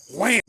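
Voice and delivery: sudden burst of sound effect; explosive wham. Retold or imitated. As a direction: punch it out like a slam. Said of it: "I talking about".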